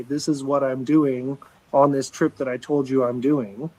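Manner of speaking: ordinary talk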